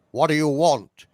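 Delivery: loud British demand, as the prisoner; forceful TV-drama British accent. Acted. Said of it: "more of an actual British accent".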